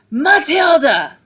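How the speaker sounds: slow, very loud, high furious bark. A narrator's reading. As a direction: bark the name like a blow. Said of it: "she barked".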